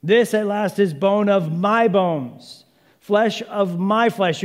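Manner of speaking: high, loud, drawn-out exclamation; thrilled, slow and loud declaration; excited. Acted. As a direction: proclaim it slowly at full voice, overjoyed. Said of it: "Man is so excited".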